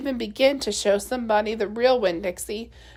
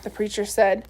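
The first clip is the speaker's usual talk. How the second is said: ordinary delivery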